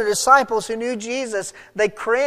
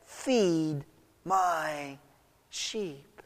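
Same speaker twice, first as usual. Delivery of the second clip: word by word, fading softer; measured gentle charge; 3 semitones lower, 7 dB quieter, much slower than usual. Acted. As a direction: pause after each word, growing quieter. Acted Jesus's final charge, word by word.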